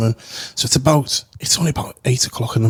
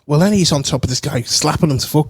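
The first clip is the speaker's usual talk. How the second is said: ordinary talk, nothing marked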